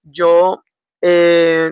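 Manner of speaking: ordinary talk, nothing marked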